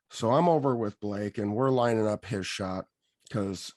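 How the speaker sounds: ordinary delivery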